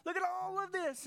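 proudly showing off the work; boastful striver. Retold or imitated. Believer boasting to Jesus of all the work, eager and proud.

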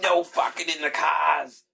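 slow, high mock Australian warning; bad mixed accent. Ship's builder laying down rules, bad Australian accent. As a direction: drag it out in a botched Boston-Australian accent. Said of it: "suck at every accent".

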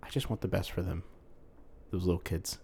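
soft, subdued regret; tender plea. Acted. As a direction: say it quietly, heavy-hearted. Acted tender plea about children.

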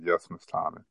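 head down, owning up; quietly confessing to the teacher; sheepish admission. Retold or imitated. Admitting guilt to teacher.

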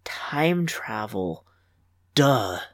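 breathy hushed whisper, slowed way down; dreamy whispered afterthought; whispered aside. A narrator's reading. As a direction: drop to a secret, slow whisper.

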